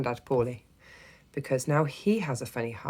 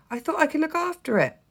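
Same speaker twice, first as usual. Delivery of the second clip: put-on high, flustered child; spluttering; 4 dB louder than usual. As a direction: splutter it out anxiously. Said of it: "I spluttered".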